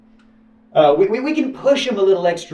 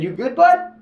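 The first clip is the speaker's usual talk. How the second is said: concerned editor; 12 semitones higher than usual. Retold or imitated. Editor checking on him, retold.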